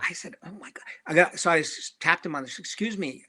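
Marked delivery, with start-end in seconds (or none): 0.4-0.8 very soft, barely breathed; starstruck whisper. Retold or imitated. Fan whispering in awe at a comedian. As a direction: gasp it under your breath.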